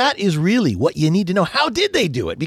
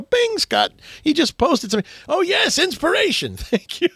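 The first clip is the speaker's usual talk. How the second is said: much higher, thrilled, imitating his own delight; mock-gleeful cheer at a new post; excited reaction; 12 semitones higher than usual. Host acting out his joy at Scott's new post, high and thrilled. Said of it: "I go".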